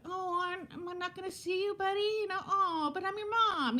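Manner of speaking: high, whiny mock guilt trip; guilt-tripping mom. Mother mock-whining to her son about not seeing him. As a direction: play the clingy mom who lays it on thick. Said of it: "I wasn't gonna be that mom".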